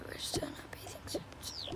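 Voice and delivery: hushed whisper to her partner; soft whisper, barely voiced. Real, not acted. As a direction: whisper it so others can't hear. Unvoiced whispered side talk.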